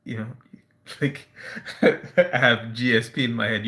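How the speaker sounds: ordinary talk, nothing marked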